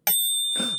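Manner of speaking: sudden high startled gasp; quick alarmed intake of breath; sharp gasp. A narrator's reading. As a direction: gasp as the thought strikes. Amelia remembers her pie.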